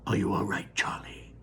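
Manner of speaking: soft whispered concern. Acted. Grandpa Joe checking on Charlie at his legs, whispering. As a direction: whisper it down to the boy.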